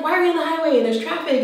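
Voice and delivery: high-pitched mock-panicky complaint; mildly frantic, whiny question; retold freak-out. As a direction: imitate yourself getting worked up. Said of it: "I was just, like, freaking out".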